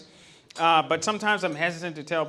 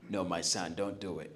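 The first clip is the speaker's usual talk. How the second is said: dad's voice; 12 semitones lower, 11 dB quieter than usual. Retold or imitated. Father refusing his son's plan.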